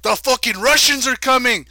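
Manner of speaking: put-on loud, high, muddled Mexican-Scottish accent; overexcited alarm in a botched Mexican accent; mock Mexican accent. Neighbor warning everyone of invasion, excited garbled Mexican accent. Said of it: "I don't know why I did that accent".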